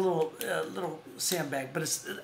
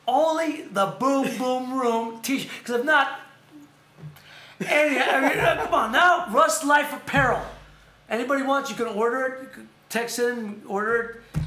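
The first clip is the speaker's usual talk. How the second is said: high and louder, hyping the shirt with a laugh; excited push; 7 semitones higher, 7 dB louder than usual. Real, not acted. Host pressing his co-host about the Boom Boom Room t-shirt, excited and loud. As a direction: sell it like it's the best thing ever.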